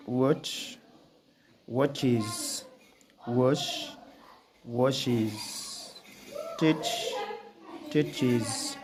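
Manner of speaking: "watches", "washes", "teaches" with a clear extra "iz" syllable; dictionary pronunciation. Shown as a demonstration. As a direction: read each word slowly, ending in "iz". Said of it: "we can pronounce it as is".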